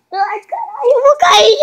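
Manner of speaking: high panicked yell; scared yell. Real, not acted. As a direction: shout as you lose balance. About to fall.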